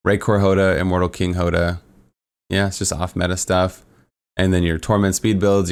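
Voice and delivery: usual speaking voice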